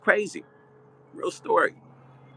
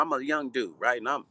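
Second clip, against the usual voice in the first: normal talking voice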